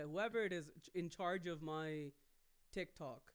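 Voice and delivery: ordinary talk, nothing marked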